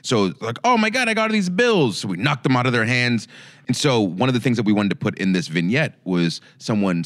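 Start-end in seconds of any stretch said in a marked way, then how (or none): 0.6-2.0 higher-pitched hammed-up dismay; mock distress. Person holding bills in a sketch, mock distress. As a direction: wail a little in pretend panic. Acted victim in sketch idea.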